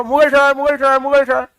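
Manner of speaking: calling out with tobacco in mouth; mouth full of gutka. Retold or imitated. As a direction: hawker call through a full mouth. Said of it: "पहले बोलता था मुंह में गुटखा लेकर". (मुंह में गुटखा लेकर पुकारना)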